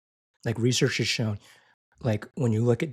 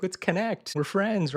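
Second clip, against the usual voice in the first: high, sweet friendly tone; warm caring voice; 8 semitones higher than usual. Retold or imitated. Warm caring voice set against clinical.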